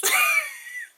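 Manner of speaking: loud-starting, squealed laugh; high shrieking laugh. Real, not acted. Unboxer speechless at an odd item, shrieking laugh.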